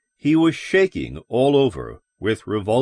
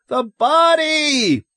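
very high, drawn-out scream; loud, desperate shriek; 5 dB louder, much slower than usual. A narrator's reading. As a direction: scream it slowly, stretching the word. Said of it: "Lois screamed".

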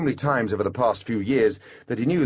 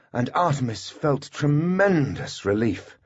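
plain reading voice

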